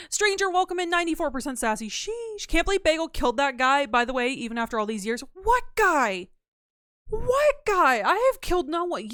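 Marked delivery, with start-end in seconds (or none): 1.9-2.5 high squeaky sheesh. Acted. Reacting to a high sassy score. 5.3-9.1 high, loud, offended denial; indignant put-on voice. To chat, denying Bagel killed anyone, high indignant squeal. Defending Bagel against a murder claim.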